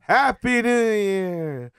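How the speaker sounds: slow, drawn-out celebratory cheer; new year cheer. Real, not acted. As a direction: call it out as the clock hits midnight. End of the countdown.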